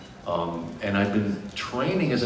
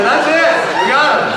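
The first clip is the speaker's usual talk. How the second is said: high, loud imitation of thrilled casters; excited exclamation; 12 dB louder than usual. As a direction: call it out like you've found him. Retold casters' excited decision to hire.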